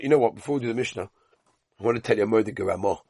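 normal talking voice

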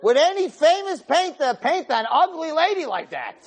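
put-on high, squawking old lady voice. Old lady tourist complaining to her friend Sadie in a high voice.

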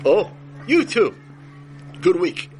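high, loud and slow, beaming; king's guest answers with overeager politeness; eager reply. Acted. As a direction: gush back at the king, drawn out. King mistakes vach for week.

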